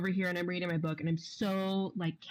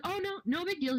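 chirpy boss; 11 semitones higher than usual. Retold or imitated. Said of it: "And he was like".

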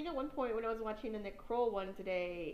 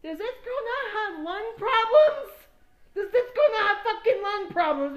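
put-on loud shocked outrage at a smoking teen; outraged yell; 12 semitones higher, 14 dB louder than usual. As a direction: shriek it in outrage. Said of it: "I'm like".

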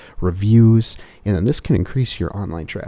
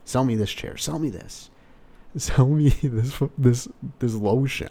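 mentor's challenge; 4 semitones higher than usual. Retold or imitated. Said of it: "when a mentor asks".

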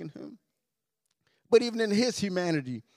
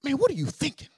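mock self-rebuke, higher pitch; self-scolding; 7 semitones higher, faster than usual. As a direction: scold yourself in mild exasperation. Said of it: "you talk to yourself".

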